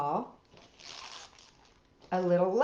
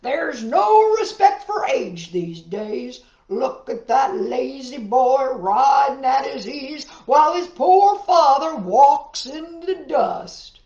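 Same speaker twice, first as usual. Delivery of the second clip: indignant farmer; 3 semitones higher, 8 dB louder than usual. Acted. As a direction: shout the grumble for all to hear. Farmer scolding the passing boy.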